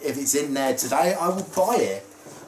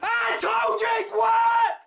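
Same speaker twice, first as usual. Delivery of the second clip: high-pitched triumphant yell; excited shout. Real, not acted. Vlogger after popping a balloon with a hairbrush, excited shout. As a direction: shriek it in triumph. Balloon trick just worked.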